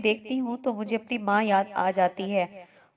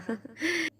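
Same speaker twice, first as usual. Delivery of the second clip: hearty laugh; 9 semitones higher than usual. A narrator's reading. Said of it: "عادل زور زور سے ہنسنے لگا". (زور زور سے ہنسی)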